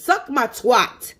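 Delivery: drawn out, each word spaced; slow and deliberate. Real, not acted. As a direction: spell it out slowly, word by word. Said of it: "That's how I say it".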